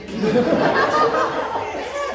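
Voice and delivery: high, loud burst of real laughter; cracking up hard at the memory. Real, not acted. Telling the shiny buff story, bursting into loud laughter.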